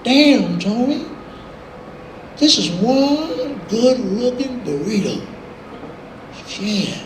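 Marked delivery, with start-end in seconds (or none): 0.0-5.2 slow, lingering country admiration; drawn-out, swooping mock appreciation. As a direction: imitate a country singer savoring the sight. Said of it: "And [name] goes".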